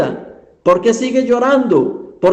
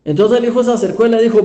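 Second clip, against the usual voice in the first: usual speaking voice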